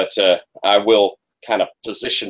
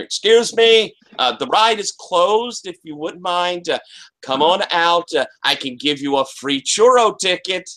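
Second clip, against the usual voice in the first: put-on higher, gentle coaxing call to a hiding girl; coaxing employee voice; 6 semitones higher than usual. Security guard coaxing a hidden girl, higher and sweet. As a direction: sweet-talk her out of hiding.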